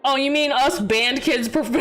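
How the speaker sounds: ordinary talk, nothing marked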